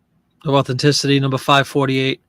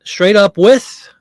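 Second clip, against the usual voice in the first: grand declaration; 6 semitones higher, 10 dB louder, slower than usual. Pretend. Posh cognac serving advice.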